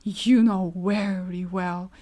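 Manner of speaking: lightly voiced menacing husband retort. Bluebeard retorting to his wife, slow and lightly menacing. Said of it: "he retorted".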